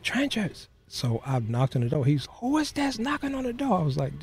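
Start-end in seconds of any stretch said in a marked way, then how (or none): 0.0-0.6 much higher drawn-out call of the name; father calling. Retold or imitated. Father calls his son from the shower, much higher. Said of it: "he was like". 2.3-3.8 much higher, annoyed call through the door; mock-irritated dad, pitched way up; father in the shower. Father annoyed at the knocking, calling much higher.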